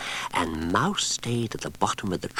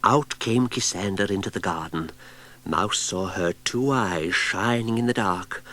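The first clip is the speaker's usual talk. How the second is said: ordinary delivery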